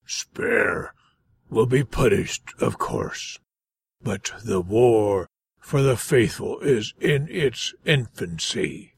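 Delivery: cold threat from a demon lord. Acted. Voices the fiend leader Van.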